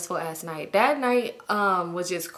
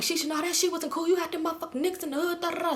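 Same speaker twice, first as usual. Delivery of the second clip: high-pitched disapproving chiding; mock scolding; 9 semitones higher, 4 dB quieter than usual. Friends scolding Shisha over the phone, mock indignant. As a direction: imitate friends telling someone off.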